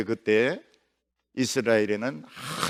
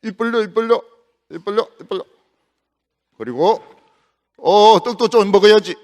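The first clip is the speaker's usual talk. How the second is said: coaxing angel; 3 semitones higher, 6 dB louder, faster than usual. Acted. 천사가 먹여주며 달램.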